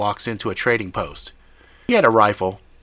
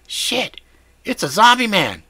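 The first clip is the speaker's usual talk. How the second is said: alarmed; 12 semitones higher, 6 dB louder than usual. Acted. Jimmy exclaims in alarm.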